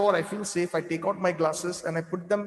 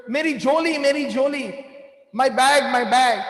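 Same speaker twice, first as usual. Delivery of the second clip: retold screaming; 3 semitones higher, 3 dB louder than usual. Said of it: "One older lady was screaming".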